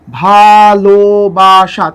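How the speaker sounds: ভালোবাসা drawn out as "bha-lo-ba-sha"; slow syllable by syllable. Shown as a demonstration. Teacher demonstrates syllables, ভালোবাসা very slowly drawn out. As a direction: stretch each of the four beats of ভালোবাসা. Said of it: "চারবার উচ্চারণ করলাম".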